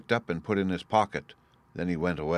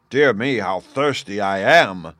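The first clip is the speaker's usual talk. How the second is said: loud, raised giant's complaint; giant crying out; 8 dB louder, slower than usual. A narrator's reading. Giant at supper after salted broth, crying out loudly. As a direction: cry out loudly, stretching the words. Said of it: "cried the giant".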